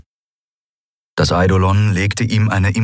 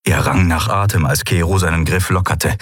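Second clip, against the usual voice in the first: usual speaking voice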